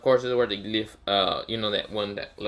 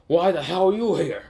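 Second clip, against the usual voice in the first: angry demand; 8 semitones higher, 5 dB louder than usual. Acted. Baki confronting an intruder.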